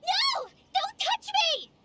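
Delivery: high, sharp cry of alarm; panicked cry. Acted. Startled girl recoils from touch, loud panicked cry.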